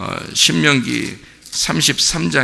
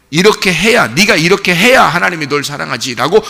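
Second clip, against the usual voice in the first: louder, higher demanding voice; loud demanding devil; 4 semitones higher, 6 dB louder than usual. Acted. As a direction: push the condition hard. (크고 높게 조건을 내거는 마귀)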